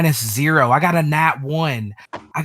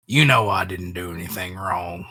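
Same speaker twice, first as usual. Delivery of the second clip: resigned compliance; 6 semitones lower than usual. Acted. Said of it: "Jebediah's like".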